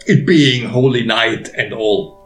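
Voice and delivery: ordinary delivery, nothing marked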